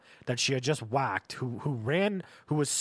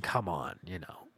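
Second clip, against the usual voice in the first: ordinary talk, nothing marked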